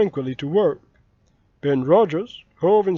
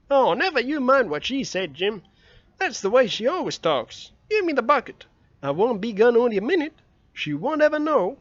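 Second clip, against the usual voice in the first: put-on higher, eager boyish voice; young Tom wheedling his friend; 5 semitones higher than usual. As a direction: coax him like a scheming boy.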